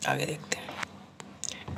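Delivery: slow, whispered aside to herself. Real, not acted. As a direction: murmur it while writing. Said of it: "aside while writing".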